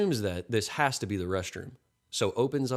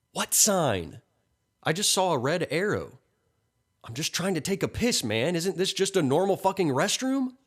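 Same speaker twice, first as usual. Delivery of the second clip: high, exasperated, pushing harder at the end; flustered protest; 9 semitones higher, 3 dB louder than usual. A narrator's reading. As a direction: plead innocence in high-pitched disbelief. Nathan protests in acted argument.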